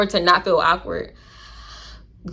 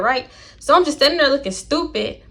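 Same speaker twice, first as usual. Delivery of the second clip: ordinary delivery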